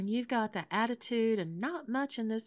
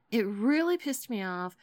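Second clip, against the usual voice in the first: ordinary talk, nothing marked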